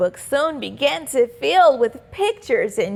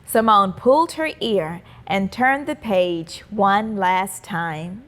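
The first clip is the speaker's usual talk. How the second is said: ordinary delivery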